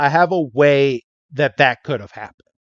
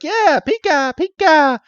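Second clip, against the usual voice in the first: squeaky high Pika Pika, pitched way up; high cartoon critter squeak; Pikachu voice; 12 semitones higher than usual. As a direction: chirp it like a tiny electric mouse. Voicing Pikachu in recap.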